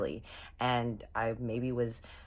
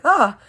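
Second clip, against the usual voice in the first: short, high, loud "ah"; startled yelp; 12 semitones higher, 14 dB louder than usual. Retold or imitated. Sneaking in, she hears him say hey, startled yelp.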